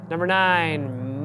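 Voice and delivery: ordinary delivery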